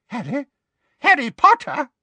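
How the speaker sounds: high, rising disbelief; startled Fudge. Acted. Minister spots the boy, high and startled. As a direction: stare and repeat the name.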